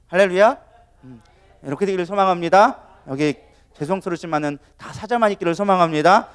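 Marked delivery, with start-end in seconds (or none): none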